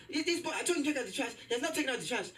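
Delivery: high, fast complaining mom. Retold or imitated. Son mimics mom telling dad about the trash, high and fast. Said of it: "She's like".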